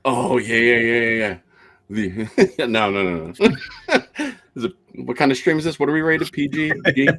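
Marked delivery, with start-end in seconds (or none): 3.4-4.3 squeaky laughing no. Real, not acted. Laughing high no.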